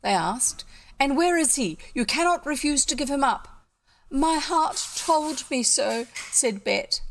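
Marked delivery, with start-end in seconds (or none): 1.0-3.5 higher, louder questioning; insistent demand. A narrator's reading. Gentlemen demand the stranger be given up, insistent raised voice. 4.1-6.1 high, slow melodramatic declaration; crying out. A narrator's reading. Bet answers the gentlemen who seek him, high drawn-out melodrama. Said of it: "cried Bet".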